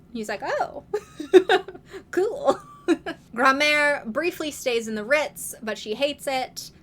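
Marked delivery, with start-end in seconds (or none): 0.4-3.0 laughing. Retold or imitated. Dad flattered by being Elf King.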